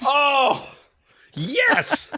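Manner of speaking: high gleeful whoop; excited cheer. Real, not acted. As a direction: cheer like you won. Celebrating nailing the ranking.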